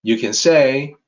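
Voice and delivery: usual speaking voice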